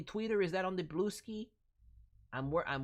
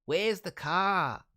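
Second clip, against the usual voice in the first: Where's the car with a clipped New Zealand vowel, louder and slow; Kiwi accent; 7 dB louder, slower than usual. Retold or imitated. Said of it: "we Kiwis are like".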